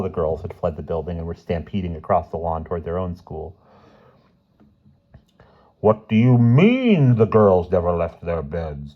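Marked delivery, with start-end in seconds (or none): 5.8-8.9 loud, slow indignant demand. A narrator's reading. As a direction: demand an answer from her. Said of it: "demanded Mister Sturgeon".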